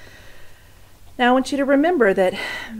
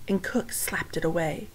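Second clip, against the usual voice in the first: ordinary delivery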